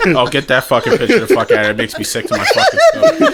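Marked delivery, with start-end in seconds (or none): 2.3-3.3 laughing. Real, not acted. Disgusted, laughing.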